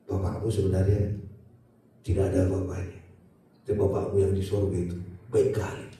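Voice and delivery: quietly humble, like a father at bedside; tender fatherly hush. Retold or imitated. Ayah berbicara lembut kepada anaknya. (lembut dan rendah, ayah menasihati anak-anaknya)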